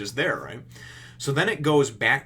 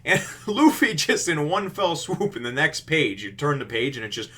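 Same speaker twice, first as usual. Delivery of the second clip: normal talking voice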